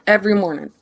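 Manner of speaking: normal talking voice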